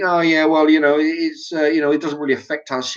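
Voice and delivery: high, hammed-up dismissive voice; mocking dismissive people. Mocking people who say it doesn't matter: high mock voice. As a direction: parody them as careless and breezy. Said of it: "people in one ear saying".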